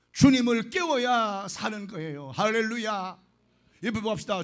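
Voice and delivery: earnest preaching to the flock. Acted. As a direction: exhort the congregation with fervour. Preaches to the congregation. (회중에게 열정적으로 설교)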